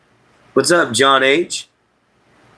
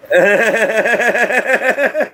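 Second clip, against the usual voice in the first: high giggle; 12 semitones higher than usual. Real, not acted. Laughs greeting viewer.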